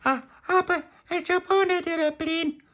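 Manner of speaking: put-on very high baby talk; little child's voice. Baby Lucia to Pauline, little child's voice. Small child character pipes up.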